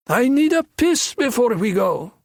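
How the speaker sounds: shrill-voiced thug. A narrator's reading. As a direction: squeak it out lazily to his partner. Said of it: "Shrill voice told his partner".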